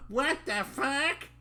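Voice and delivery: squeaky-high, drawn-out voice; character's put-on voice. Reader finding the character's voice, high comic squeal. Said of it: "That's his voice".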